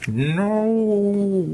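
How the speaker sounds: high, drawn-out muffled wail; muffled cry. A narrator's reading. Gagged captive mother protests to her captors, muffled cry. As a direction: cry out through a gag. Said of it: "produced a muffled".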